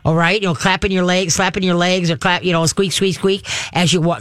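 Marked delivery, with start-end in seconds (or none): none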